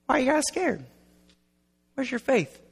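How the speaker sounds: small mock-concerned voice; mild gentle asking. Shown as a demonstration. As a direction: ask it lightly, without any force. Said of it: "He's not going".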